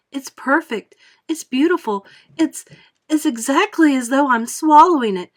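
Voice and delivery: high, loud rapturous gushing; ecstatic. A narrator's reading. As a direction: savour every word in delight. Girl raves about gum flavour.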